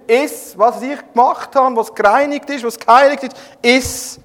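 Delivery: laut und höher, befehlend; gebietende Gottesstimme. Acted. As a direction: sprich als Gott, der Petrus befiehlt. Stimme Gottes befiehlt. (Gott befiehlt Petrus zu essen, laut und gebietend)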